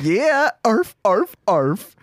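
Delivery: high yappy arf; hammed-up barking in a playful bit; dog barking. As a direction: yap like a small dog. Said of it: "a dog walrus barking".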